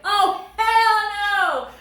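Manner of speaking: high, loud, drawn-out outburst; appalled laughing shout. Real, not acted. Reader reacting to husband's baby talk, appalled laughing shout. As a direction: wail it out slowly, breaking into laughter.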